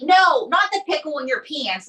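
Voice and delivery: ordinary talk, nothing marked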